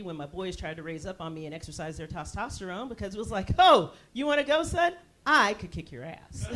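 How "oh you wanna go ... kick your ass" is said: loud, higher-pitched dare; mock tough challenge. Mom facing down her testosterone-filled boys, loud mock challenge. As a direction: square up to a cocky kid, landing the last words slowly.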